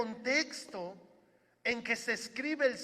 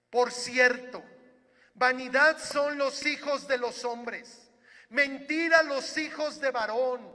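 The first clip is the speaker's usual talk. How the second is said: louder, impassioned psalm reading, slowing on key words; fervent proclamation; 4 semitones higher, 8 dB louder than usual. Acted. Pastor proclaiming trust in God to the congregation, fervent and louder. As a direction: proclaim it like a preacher lifting the crowd.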